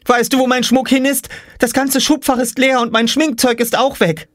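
very high, agitated voice; upset girl. Acted. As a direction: wail it at Mum in high outrage. (aufgebrachte, sehr hohe Mädchenstimme)

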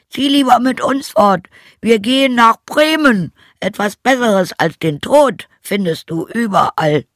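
high, loud donkey voice; rallying donkey character. Acted. Donkey invites the old hound to make music, rallying donkey voice. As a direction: call out the plan high and strong.

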